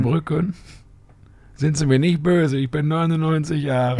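put-on high frail old lady voice; old grandma voice. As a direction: plead like a confused old lady. Quoting the grandma video.

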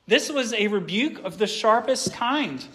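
ordinary delivery

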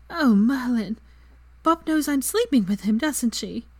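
high-pitched, mild dismay; horrified realisation. A narrator's reading. Girl realising the elf knows, horrified realisation. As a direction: realise it with embarrassed horror. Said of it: "covered her face with her hands in horror".

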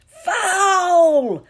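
very high, long drawn-out shout; full-throated roar of protest; scream. A narrator's reading. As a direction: scream it like an outraged crowd. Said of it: "screamed the Gryffindors".

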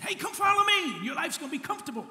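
high, loud, breezy sales pitch; mock cheery invitation. Mocking a false Jesus promising comfort, hammed-up cheer. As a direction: sell the easy life like a huckster.

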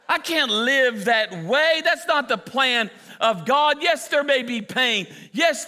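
high, impassioned pulpit cry; full-force preaching. Real, not acted. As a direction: preach it with rising fervor, stretching key words.

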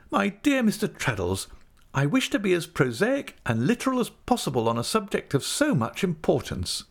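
high-pitched, emphatic lady's speech; Mrs Micawber, earnest. A narrator's reading.

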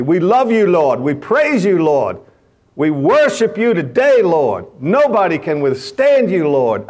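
full-voiced worship cry, like a locker room prayer; shouted praise. Preacher showing how the players praise the Lord, shouting high and loud.

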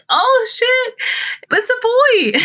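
very high, excited squeal with laughter; shocked excitement. Retold or imitated. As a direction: relive the thrill of the reveal. Reliving seeing the blue confetti.

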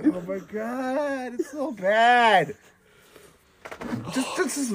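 slow, choked disgusted moan; gagging. Real, not acted. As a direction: drag it out, nearly retching. Gagging at a lingering smell.

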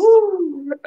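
high whoop then a laugh; thrilled woo and laugh; excited whoop. Real, not acted. Excited after the exercise.

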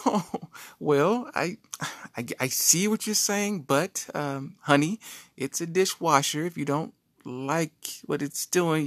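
high, sugary wife voice; wife's voice. Retold or imitated. Wife humoring her husband about his hard work, high, sweet voice. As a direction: pitch it up like a sweet, patronizing wife.

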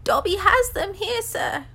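very high, loud elf voice; Dobby. A narrator's reading. House-elf admits having the letters, high and eager. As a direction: pipe up as an eager servant elf.

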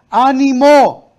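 loud, high, drawn-out retold encouragement; rousing call. Preacher quoting Jesus to the fearful, rousing call. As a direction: call it out slowly and boldly.

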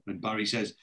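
usual speaking voice

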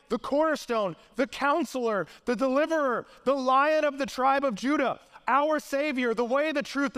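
impassioned preaching. Real, not acted. Preacher proclaims who Jesus is, impassioned and insistent. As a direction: build title upon title with rising fervour. Preacher builds litany of Christ's titles.

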